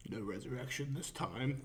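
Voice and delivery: hushed, low-key villain line, badly imitated; quiet, menacing movie-villain impression; Josh Brolin as Thanos. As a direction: do a bad, soft Thanos impression. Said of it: "very bad Josh Brolin impression".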